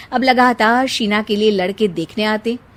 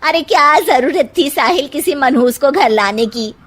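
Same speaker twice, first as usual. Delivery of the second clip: loud, high scolding aunt voice; accusing aunt; 4 semitones higher, 5 dB louder than usual. A narrator's reading. Aunt accusing Sahil of bringing an unlucky bride, loud. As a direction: spit the blame at him like a bitter aunt.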